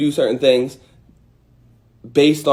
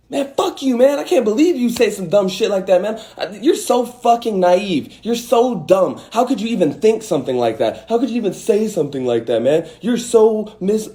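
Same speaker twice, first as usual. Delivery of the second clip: much higher, heated mock rant; mock angry rant; 7 semitones higher than usual. Acting out a triggered person ranting, higher mock anger. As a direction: rant like someone just triggered. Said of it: "your response to that now is".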